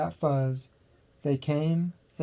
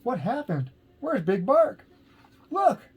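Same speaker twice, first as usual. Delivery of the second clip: alarmed; 9 semitones higher than usual. A narrator's reading. Crowd alarmed, eagle appears.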